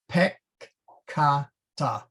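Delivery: "peccata" as pek, ka, ta with gaps; split syllables. Shown as a demonstration. Teacher showing double-letter split, slow split syllables. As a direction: slowly separate "peccata" between the two c's. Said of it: "split between the two letters".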